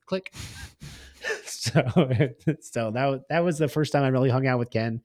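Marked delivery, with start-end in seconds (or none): 0.4-1.4 quiet laugh. Real, not acted. Laughing at the story. 1.9-2.5 a loud, high burst of laughter; real laugh. Real, not acted. Laughing at the story.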